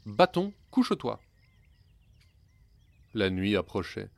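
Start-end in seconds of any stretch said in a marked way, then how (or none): 0.0-1.2 "Bâton, couche-toi" cried out much higher; desperate command. A narrator's reading. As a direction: beg the stick to stop. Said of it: "au son de".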